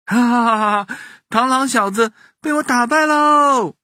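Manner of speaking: put-on high gloating boy; laugh, then crow the victory; triumphant laughing. A narrator's reading. Boy has beaten the mantis, laughing and crowing.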